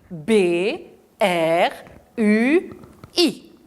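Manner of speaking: letter by letter: B, R, U, I, each named separately; spelling out. Shown as a demonstration. Teacher spelling bruit to the class. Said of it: "c'est dire lettre par lettre".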